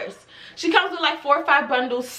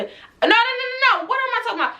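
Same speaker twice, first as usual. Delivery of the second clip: flustered self-correction; 10 semitones higher, faster than usual. Real, not acted. Correcting her own retelling.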